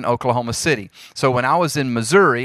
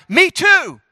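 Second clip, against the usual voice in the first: high, loud enthusiastic chime-in; bursting to agree; eager agreement; 5 dB louder than usual. Acted. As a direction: jump in keen and high-pitched. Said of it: "causes somebody else to be like".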